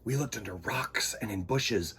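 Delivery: put-on gentle read-aloud to children; story narration. A narrator's reading.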